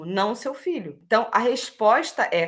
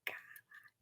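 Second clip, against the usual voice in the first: breathy whispered swear word; a whispered startled curse; 25 dB quieter than usual. Retold or imitated. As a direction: hiss the curse under your breath, startled.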